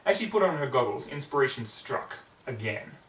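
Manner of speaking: ordinary delivery, nothing marked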